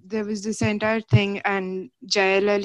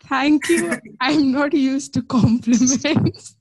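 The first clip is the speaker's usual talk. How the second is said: laughing; 5 semitones higher, 5 dB louder than usual. Real, not acted. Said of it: "you're too busy laughing".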